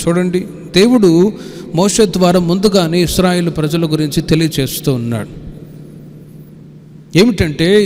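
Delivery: ordinary talk, nothing marked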